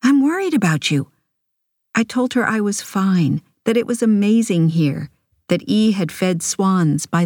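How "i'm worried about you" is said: lightly voiced anxious mother, higher pitch; worried mother. A narrator's reading. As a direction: fret over your child, pitched up.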